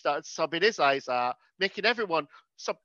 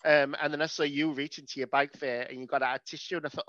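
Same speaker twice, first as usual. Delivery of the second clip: ordinary talk, nothing marked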